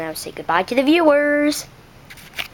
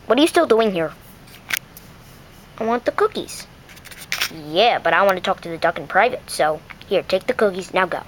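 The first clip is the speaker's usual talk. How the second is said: lower, hurried, shooing tone; low scheming voice; 8 semitones lower, 3 dB quieter, faster than usual. Acted. Lower schemer sending Clarice away with cookies. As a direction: get rid of the other one.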